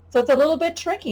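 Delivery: ordinary talk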